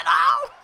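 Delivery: very high, loud yelp; sharp yelp. Real, not acted. Player reacting to a slam onto cement, sharp yelp. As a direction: yelp at the impact.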